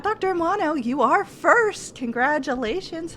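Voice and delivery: excited greeting. Real, not acted. Greeting first chatter on stream.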